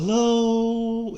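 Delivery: high lilting sing-song hello; sing-songy. Retold or imitated. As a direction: croon the greeting like a little girl. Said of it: "in a sing-songy".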